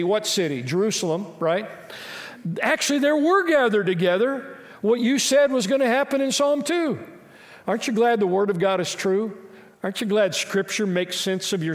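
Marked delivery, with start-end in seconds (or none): 2.6-7.0 higher, rising excitement; excited retelling. Retold or imitated. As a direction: announce it with building enthusiasm.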